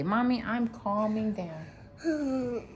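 put-on slow, softly spoken line for the child to copy; slow model phrase. Shown as a demonstration. Mother slowly models the words for her daughter to repeat.

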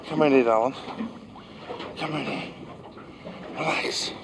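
fading to a soft whisper; soothing coax. Real, not acted. Angler coaxing the big shark to relax, soothing.

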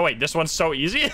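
ordinary talk, nothing marked

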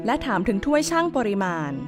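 ordinary delivery, nothing marked

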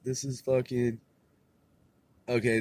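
ordinary talk

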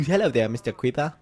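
hammed-up British gentleman addressing a creeper; playfully exaggerated British accent; mock British accent. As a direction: put on a silly, over-polite British voice. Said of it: "Why am I talking like that? I'm not even freaking British".

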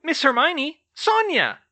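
very high, loud elf exclamation; squeaky, amazed little-elf voice; gasped. A narrator's reading. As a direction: cry out in astonishment. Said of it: "he gasped".

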